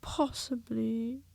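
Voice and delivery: slow, dull one-word reply; gloomy donkey, slowly; unimpressed. Acted. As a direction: drag it out, completely unmoved. Eeyore answers dully.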